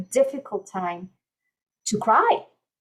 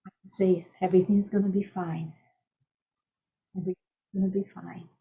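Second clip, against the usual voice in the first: calming mantra; 2 semitones lower, 6 dB quieter, faster than usual. Shown as a demonstration. Coach demonstrating a one-minute mantra, calm and unhurried. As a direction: soothe yourself gently, taking your time. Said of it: "Create a little mantra that you can do".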